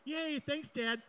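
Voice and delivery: put-on high-pitched kid's cheer; hammed-up youthful glee; mock delight. Imitates his delighted younger self.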